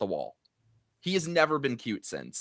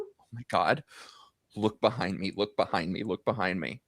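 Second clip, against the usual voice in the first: hushed, urgent whisper; retold whisper; 8 semitones lower, 5 dB quieter than usual. Friend whispering that the celebrity sits behind. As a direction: breathe it low so he won't hear.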